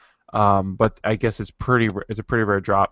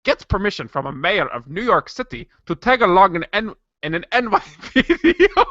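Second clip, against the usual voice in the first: put-on higher-pitched amateur Irish accent, breaking into giggles; attempted Irish accent; 8 semitones higher than usual. Podcaster cracking up while reading a show description, attempted Irish accent. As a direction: try an Irish accent until laughter wins. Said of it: "can I do an Irish accent instead?".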